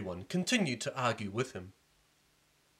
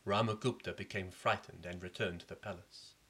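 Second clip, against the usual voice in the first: ordinary delivery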